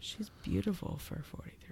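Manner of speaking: soft whispered aside; whispering. Real, not acted. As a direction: whisper it half to yourself. Whispered aside about actress.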